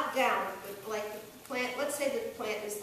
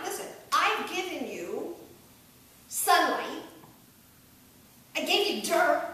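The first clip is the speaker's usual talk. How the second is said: mock-aggrieved, raised and louder; funny reproach; 4 semitones higher, 6 dB louder than usual. Shown as a demonstration. Coach demonstrates the lyric with comic reproach. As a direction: tease the plant for what it got. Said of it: "That's supposed to be funny".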